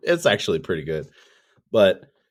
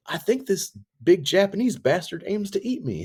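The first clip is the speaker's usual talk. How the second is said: very high goofy character voice; high silly voice; 13 semitones higher, 3 dB louder than usual. Retold or imitated. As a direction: squeal it out in mock panic. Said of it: "he's just like".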